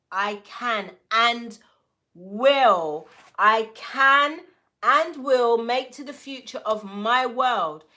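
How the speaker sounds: rallying shout; loud declaration. Real, not acted. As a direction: proclaim it with full force. Affirmation shouted.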